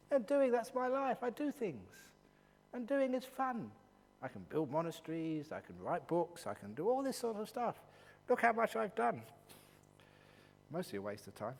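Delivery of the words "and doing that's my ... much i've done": higher, boasting mock enthusiasm; mock pride. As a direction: play the busy doer showing off. Mocking a busy, proud person.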